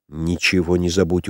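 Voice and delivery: usual speaking voice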